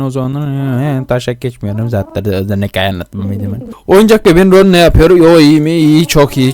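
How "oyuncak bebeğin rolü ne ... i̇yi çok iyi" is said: loud, higher, fast alarmed talk; startled outburst. Real, not acted. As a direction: blurt it out in a fright. Startled reaction in horror game.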